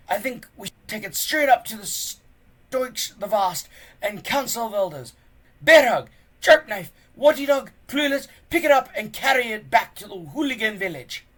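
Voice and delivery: high, loud, fast rush of orders; a big teacher carried away by the find; excited teacher. Acted. Gobber ordering boys to carry the coffin, excited and loud. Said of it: "just as excited as everyone else".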